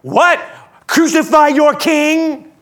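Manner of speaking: high, loud, drawn-out question; incredulous shout. Acted. Pilate challenging the yelling crowd, loud incredulous shout. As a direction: throw it back at the mob in disbelief. Pilate throws the demand back.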